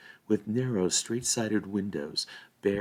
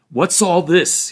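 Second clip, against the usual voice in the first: loud, slow, menacing snarl; 10 semitones higher, 11 dB louder, slower than usual. A narrator's reading. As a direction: growl it at the intruders. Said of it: "he snarled".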